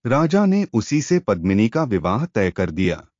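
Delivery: ordinary delivery, nothing marked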